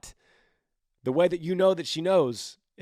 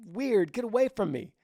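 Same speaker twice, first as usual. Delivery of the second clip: creeped-out brush-off; 8 semitones higher than usual. Pretend. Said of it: "she'd be like".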